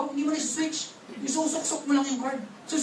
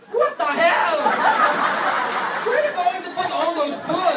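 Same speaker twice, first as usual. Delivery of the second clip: high, louder incredulous American diner; comic retold indignation, pitched up; mock outrage; 4 semitones higher, 4 dB louder than usual. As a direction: exclaim in hammed-up disbelief. Acts an American shocked at plate.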